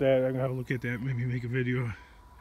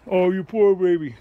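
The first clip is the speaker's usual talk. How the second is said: mocking; 4 semitones higher, 8 dB louder than usual. Mocking imagined listener.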